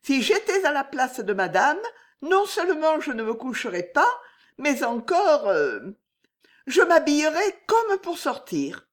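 high, pressing, nervous maid; urgent warning. Acted. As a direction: urge her mistress to dress.

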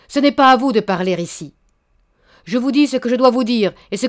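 loud, furious tirade; terrible anger. Acted. Baron berating the suitor, loud and angry. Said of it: "le baron eut un geste terrible".

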